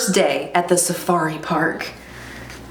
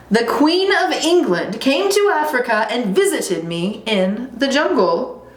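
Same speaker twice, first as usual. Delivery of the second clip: higher, self-important storytelling; boastful lion cub; 5 semitones higher than usual. Acted. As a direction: tell a grand tale as a proud cub.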